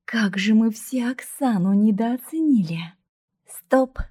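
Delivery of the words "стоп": short, higher, louder command; sharp command. Acted. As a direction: bark the order at a friend. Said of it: "скомандовала кузина". (резкая команда, громче и выше)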